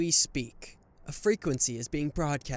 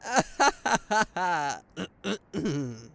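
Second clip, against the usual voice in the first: loud awkward cackle; nervous villain laugh; 2 semitones higher, 4 dB louder than usual. Acted. Villain laughing off the exploding people. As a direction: laugh off the chaos.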